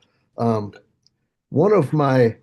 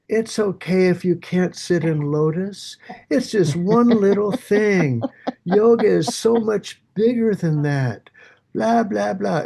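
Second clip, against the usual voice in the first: higher, slow, soothing yoga teacher; 7 semitones higher than usual. Retold or imitated. The teacher comforting students, mock soothing. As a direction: coo it like a patient teacher, then trail into blah. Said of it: "looking at people and going".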